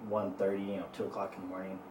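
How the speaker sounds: ordinary talk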